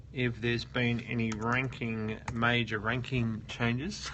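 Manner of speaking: usual speaking voice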